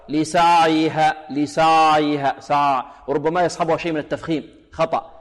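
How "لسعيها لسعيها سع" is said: slow لسعيها with the ع slack and running on like a vowel; over-loose ayn. Shown as a demonstration. Teacher imitating the opposite mistake, لسعيها with a lax ayn. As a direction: let the ع of لسعيها flow too freely. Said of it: "يعطيها رخاوة أكثر".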